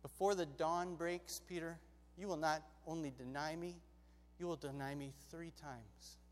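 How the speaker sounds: soft, low, measured prediction; solemn Jesus. Acted. Jesus solemnly foretells Peter's denial.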